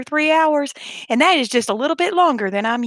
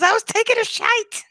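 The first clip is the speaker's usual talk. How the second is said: put-on husband's British accent; 12 semitones higher than usual. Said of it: "say it with a British accent".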